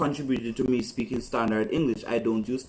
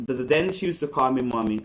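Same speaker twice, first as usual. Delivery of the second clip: normal talking voice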